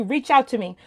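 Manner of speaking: usual speaking voice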